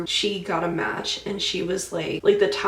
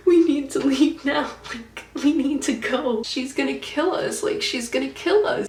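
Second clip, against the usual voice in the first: pleading; 5 semitones higher than usual. Retold or imitated. Daughter begging her mom to flee the dream, higher urgent plea. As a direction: beg her to get out now.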